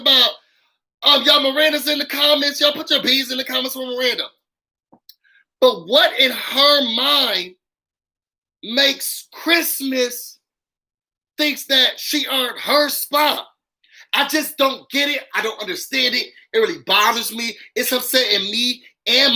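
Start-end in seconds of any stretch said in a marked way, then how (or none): 5.6-13.5 each word dragged out in disbelief; slow, pointed rhetorical question. Real, not acted. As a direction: stretch it out like you can't believe it. Repeated incredulous question.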